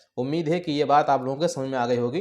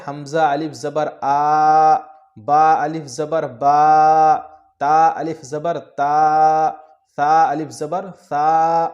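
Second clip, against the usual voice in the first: slow loud spelling drill; 6 dB louder, much slower than usual. Shown as a demonstration. Teacher drilling pupils in slow loud spelling. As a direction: chant each spelling slowly and loudly. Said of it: "بالکل ویسی ہی آواز نکل رہی ہے".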